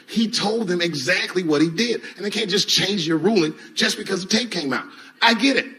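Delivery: ordinary delivery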